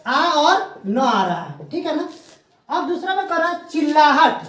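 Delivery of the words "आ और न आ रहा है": slowed breakdown. Shown as a demonstration. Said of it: "आन, यानी कि".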